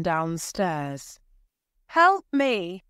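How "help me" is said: loud, high desperate call; calling for help. A narrator's reading. Wife calls to husband for help, loud and desperate. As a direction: plead for help from afar. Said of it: "she called to her husband".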